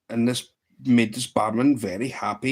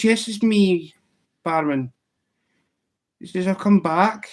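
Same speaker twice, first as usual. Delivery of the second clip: high, louder rabbit voice announcing its return; the ghost rabbit pops back into the pub; 8 semitones higher, 6 dB louder than usual. Acted.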